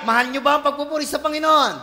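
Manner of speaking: loud, fast call to the crowd; rallying shout. Real, not acted. Preacher shouting a fast, loud question to the congregation. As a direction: shout the question out to the whole room.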